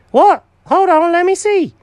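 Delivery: very high, loud surprise; cat hearing the job is done, high and loud; surprised cat. Acted. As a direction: gasp it out, amazed. Jenkins surprised.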